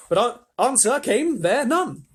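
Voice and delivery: high, loud, drawn-out narration; dramatic declaiming. A narrator's reading. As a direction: declaim it slowly and grandly. Narrator relishes line, then repeats.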